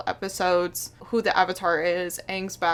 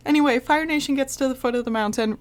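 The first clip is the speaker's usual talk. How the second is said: ordinary delivery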